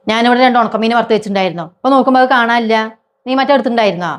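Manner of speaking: shouting accusation at her sister-in-law; angry accusation. Acted. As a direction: demand an answer hotly. Accusing over missing dried fish.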